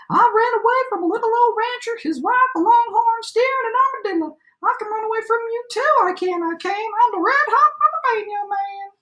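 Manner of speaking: high-pitched gingerbread cowboy voice; boastful cowboy shout. A narrator's reading. Gingerbread cowboy brags to the animal chasing him, high boastful shout. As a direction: crow it high, like a bragging runaway. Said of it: "he shouted".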